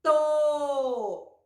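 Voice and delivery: To drawn out on the vowel; blended syllable demo. Shown as a demonstration. Teacher repeating T and O together, sounded out. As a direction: hold the o in To long. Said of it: "De novo".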